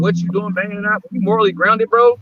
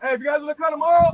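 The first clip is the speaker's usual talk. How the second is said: higher-pitched coach calling out; 8 semitones higher, 3 dB quieter, faster than usual. Acted. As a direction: call it across the gym like a coach. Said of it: "that's that coach voice".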